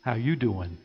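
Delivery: first weak try at the pickup line; a hint of a flirty sitcom greeting; half-hearted Joey impression; Joey Tribbiani. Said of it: "As Joey Tribbiani from Friends would say".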